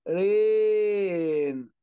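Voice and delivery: رين with the vowel held very long. Shown as a demonstration. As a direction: stretch the "ay" of رين slowly. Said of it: "إمتى همد في الإيه". (مدّ صوت الإيه في «رين»)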